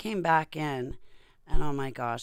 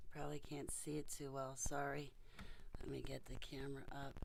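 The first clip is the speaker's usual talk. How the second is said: whispered aside; 15 dB quieter than usual. Real, not acted. Whispering aside while adjusting camera.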